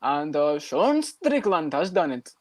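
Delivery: put-on video commentator voice; Vlad. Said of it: "exactly how he does in his videos".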